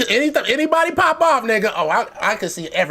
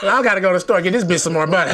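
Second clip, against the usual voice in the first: fast mock errand; faster than usual.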